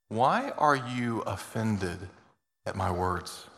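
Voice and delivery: slow, soft questioning as Jesus; slow gentle rebuke. Acted. Preacher voicing Jesus asking his followers, slow and soft. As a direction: draw out each word softly, grieved not angry.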